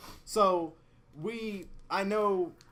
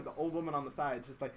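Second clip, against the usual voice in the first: ordinary talk, nothing marked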